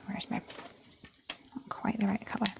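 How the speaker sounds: whispered to herself; soft unvoiced self-talk. Real, not acted. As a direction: whisper it under your breath. Whispered to self while drawing.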